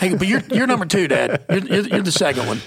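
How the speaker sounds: put-on laughing through a kid voice; high, giggly reassurance. Son consoling dad, laughing.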